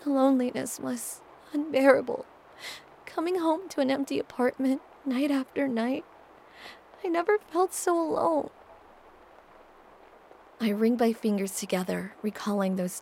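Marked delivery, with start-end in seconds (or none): 0.0-8.5 high, quavering and tearful. Acted. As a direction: near tears, voice quavering. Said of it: "voice quavering".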